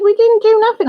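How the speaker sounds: high, loud indignant denial in a friend's voice; imitating friend's protest. Retelling to viewers how Annie denied it, high indignant. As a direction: protest innocence, squeaky and put out.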